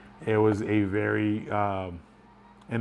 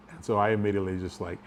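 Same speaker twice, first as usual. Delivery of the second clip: usual speaking voice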